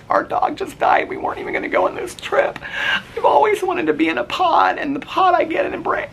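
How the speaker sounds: very high, squeaky imitation of tearful wailing; mock crying. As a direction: blubber it out in an exaggerated squeal. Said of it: "I, like, started crying".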